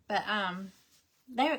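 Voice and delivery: ordinary talk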